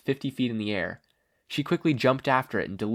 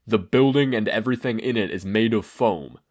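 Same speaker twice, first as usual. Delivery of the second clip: commanding declaration; 6 dB louder than usual. A narrator's reading. Izuku declaring his quirk command.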